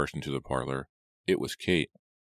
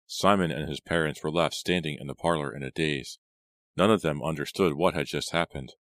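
plain reading voice

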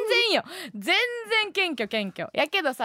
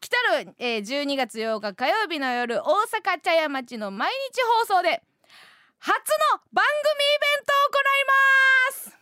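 announcer read that climbs much higher and louder; big announcement; 10 semitones higher, 4 dB louder than usual. Acted. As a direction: belt it out like a grand event announcement. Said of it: "一回目のつもりで言いますよ".

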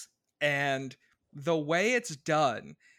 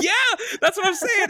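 laughing; 14 semitones higher, 3 dB louder than usual. Real, not acted. Laughing while agreeing.

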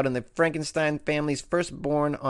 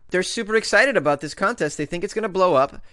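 ordinary delivery

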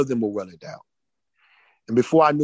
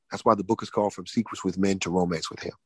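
normal talking voice

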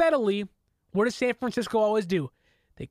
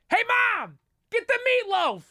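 excited yell; 13 semitones higher than usual. Retold or imitated. Fan yelling to his mom during the game, high excited shout. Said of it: "I'll be yelling and screaming".

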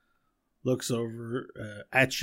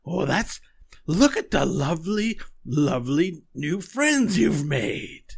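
higher, louder cooing crone, slow at the end; old hag; 6 semitones higher, 8 dB louder, slower than usual. Acted. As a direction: gush over the new friends like a hungry old witch. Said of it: "She goes".

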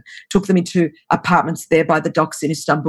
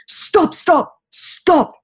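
put-on high-pitched Russian-accented plea; thick Russian accent; 11 semitones higher, much slower than usual. Russian girl interrupts talk of God's goodness, thick Russian accent. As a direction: imitate a Russian girl cutting in, voice jumping high. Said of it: "just with a thick Russian accent".

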